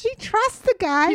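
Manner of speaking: high, slow disbelieving echo; amazed, drawn-out repeat; incredulous. Real, not acted. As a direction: draw it out in high-pitched disbelief.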